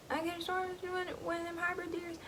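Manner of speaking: put-on a hunter repeating his excuse in a high country accent. Hunter defending his shot to the other, in a country accent. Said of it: "I give them, like, little country, country accents".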